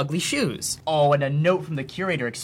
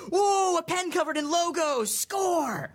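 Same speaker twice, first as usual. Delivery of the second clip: excited; 14 semitones higher, slower than usual. Acted. Gushing over junk pen.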